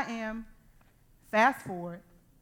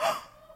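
sharp gasp. Retold or imitated. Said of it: "I was like".

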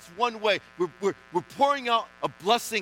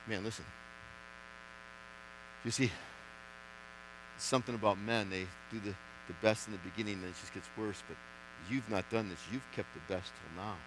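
low, hushed confiding tone; a quiet man-to-man aside; 10 semitones lower, 14 dB quieter than usual. Retold or imitated. Master of the feast confides, quiet.

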